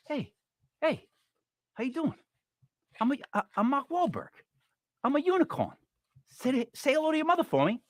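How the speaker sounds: breezy hey-how-you-doing Boston guy, only a hint of it; Mark Wahlberg impression. Does Mark Wahlberg as unicorn.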